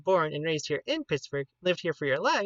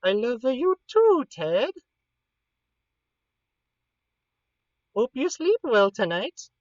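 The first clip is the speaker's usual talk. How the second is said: affectionate; 7 semitones higher, 4 dB louder than usual. Real, not acted. Saying good night to Ted.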